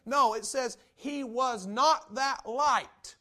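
NOT shouted, slow and hammered. Real, not acted. Preacher thunders the verse to the church, slow and shouted. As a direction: hammer "not" with each word spaced out.

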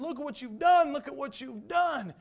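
loud, high angry yelling; furious man yelling over his shattered clock; retold yelling. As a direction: holler it at the clumsy stranger. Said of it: "he's yelling and hollering".

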